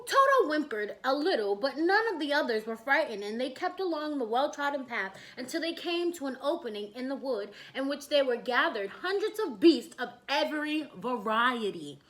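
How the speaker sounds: usual speaking voice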